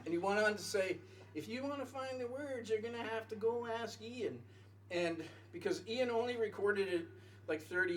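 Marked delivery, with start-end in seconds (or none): 1.4-4.4 put-on Texas accent. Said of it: "this is my Texas accent".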